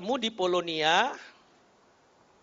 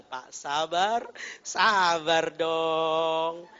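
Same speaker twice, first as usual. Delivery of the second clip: very slow, drawn-out calming appeal; acted soothing plea; 2 semitones lower, much slower than usual. Pastor holds the angry driver's thigh, slowly soothing him. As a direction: stretch the words, gently coaxing.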